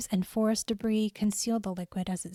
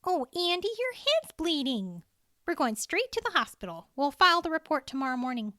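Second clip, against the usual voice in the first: higher voice, worried then brisk; a hint of concern, then take charge; lightly voiced concerned woman; 7 semitones higher than usual. A narrator's reading. Cheryl worried about Andy's bleeding head, higher and concerned.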